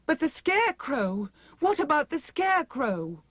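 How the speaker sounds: put-on high, worried girl's voice; Dorothy asking after the Scarecrow; anxious girl. A narrator's reading. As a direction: press them for news of a friend.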